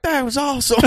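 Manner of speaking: high mock Southern cheer; Southern impression voice. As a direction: imitate an impressed Southern bro. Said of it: "whenever you do that voice".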